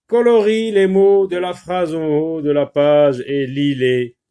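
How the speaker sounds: high, loud classroom announcement; projected, deliberate dictation voice; teacher reading instructions. Shown as a demonstration. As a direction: read the task out emphatically to pupils. Teacher reads out the exercise instruction.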